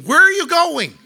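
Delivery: put-on loud call after kids running ahead.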